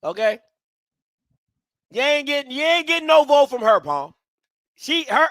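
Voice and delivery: normal talking voice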